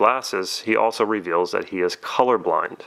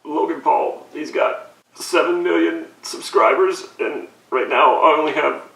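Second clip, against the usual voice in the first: higher whimpering fake sob; mock tearful whine; 8 semitones higher than usual. Mocking Logan Paul, he whines about his subscriber count. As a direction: whine it like you're about to cry. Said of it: "I'm getting a little emotional".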